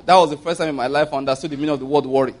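ordinary talk

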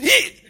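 sudden high, loud "Hey!"; startled cry. Acted. Husband showing how he wakes suddenly at night, startled yelp. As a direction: yelp as if jolted out of sleep. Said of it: "wake up suddenly as if".

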